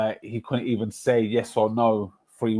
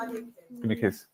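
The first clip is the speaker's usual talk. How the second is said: tender goodnight; 12 semitones higher, 7 dB quieter than usual. Real, not acted. Saying goodnight to his daughter.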